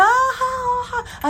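very high, shrieking laugh; friend laughing. Retold or imitated. Friend in the next stall shrieking with laughter. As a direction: cackle at her in disbelief. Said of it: "She's like".

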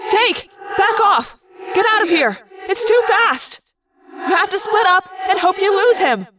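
high, urgent calls to flee; urgent warning. A narrator's reading. Rachel to Jake under attack, urgent warning. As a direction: shout him away from danger.

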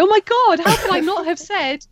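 high, exasperated mock realisation; mock regretful outburst. Guest imagining forgotten favourites after the call, mock regretful outburst. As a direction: blurt it as a hammed-up afterthought.

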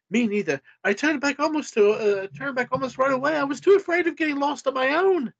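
high, hurried British voice; Andrea, British. A narrator's reading. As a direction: put on a high, quick British accent. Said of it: "my Andrea suddenly became British".

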